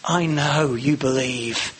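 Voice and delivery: woo him gently, drawn out. Shown as a demonstration. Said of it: "a bit of wooing".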